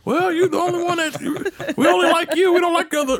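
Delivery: high-pitched old-timer imitation; comic high insistence; old drivers' reply. Old drivers answering him, retold.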